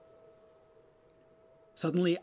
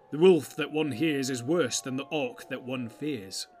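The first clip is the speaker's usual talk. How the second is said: ominous warrior; 2 semitones higher, 8 dB louder than usual. Acted. Warrior quotes a grim proverb.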